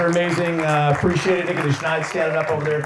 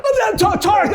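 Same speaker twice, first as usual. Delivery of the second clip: much higher, loud mock panic cry; retold panic; 4 dB louder, slower than usual. Host retelling his on-air blunder, mock panic. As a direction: yelp the name in mock horror. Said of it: "and I'm like".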